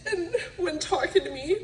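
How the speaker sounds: usual speaking voice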